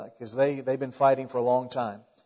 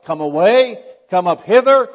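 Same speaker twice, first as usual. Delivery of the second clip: loud call rising higher at the end; acted shout; 3 semitones higher, 9 dB louder than usual. Preacher voices the Lord calling his bride away, loud shout. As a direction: proclaim each phrase as a summons. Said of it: "he's gonna say something like".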